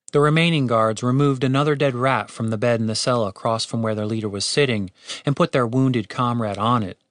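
ordinary delivery, nothing marked

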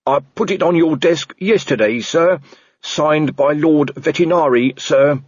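dutiful junior reporting to his boss; earnest constable. Acted. Constable Shu voiced in story.

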